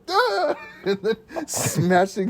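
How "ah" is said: high, sharp mock wizard shout with a laugh; wizard battle cry. Gandalf casting out Saruman, a high mock shout. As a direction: belt out a quick hammed-up "Ah!". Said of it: "he's just like".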